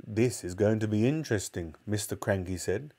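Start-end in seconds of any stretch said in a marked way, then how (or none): none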